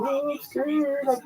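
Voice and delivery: put-on high, slow whiny child's voice; a kid pleading with his parents, high and slow; scared kid. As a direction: whimper it like a frightened little boy. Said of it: "And I'm like".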